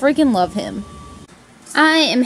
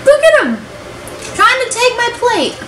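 high mock whine; 10 semitones higher than usual. Real, not acted. Playful plea, cats at plate.

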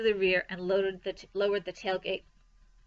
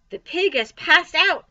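high, loud cry of alarm; alarmed announcement; 8 semitones higher, 9 dB louder than usual. A narrator's reading. Woman seeing the pig faint, calling in alarm. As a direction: call out the emergency.

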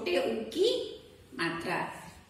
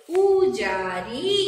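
slow blended reading; 5 dB louder, much slower than usual. Shown as a demonstration. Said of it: "र में बड़ी की मात्रा री".

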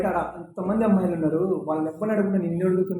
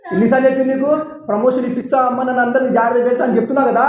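louder, higher, fed-up yelling; angry shout; 7 semitones higher, 6 dB louder than usual. Acted. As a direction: shout her down in frustration. Boss losing patience, pressuring her. (కోపంతో గట్టిగా అరుస్తూ బెదిరించడం)

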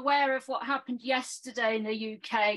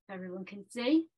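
normal talking voice

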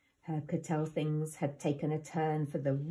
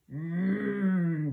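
low greedy wolf; 3 dB louder than usual. A narrator's reading. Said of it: "in a low, greedy voice".

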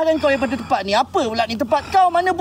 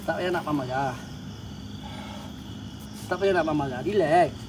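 soothing hush; 9 semitones lower, 6 dB quieter than usual. Real, not acted. Calming an angry spirit.

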